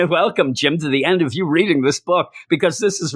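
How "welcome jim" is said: ordinary talk, nothing marked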